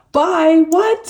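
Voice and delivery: real jolt of surprise; startled. Real, not acted. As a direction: blurt out in shock. Startled by the letters.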